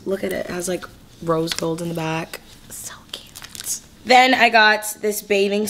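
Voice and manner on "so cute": whispering. Real, not acted. Whispered aside on new shirt.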